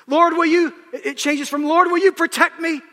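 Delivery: high, loud sermon cry; preaching at full force. Acted. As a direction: lift the church with a ringing call.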